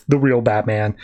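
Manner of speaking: ordinary talk, nothing marked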